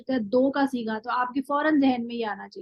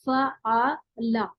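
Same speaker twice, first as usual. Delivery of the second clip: slow demo word; much slower than usual. Shown as a demonstration. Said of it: "फा इन लाम की डाई को".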